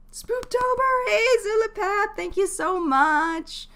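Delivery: high, loud, drawn-out cheer of thanks; gushing, high-pitched stream welcome; excited shout-out. Real, not acted. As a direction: squeal a big, stretched-out thank-you to a supporter. Streamer greets supporter in chat.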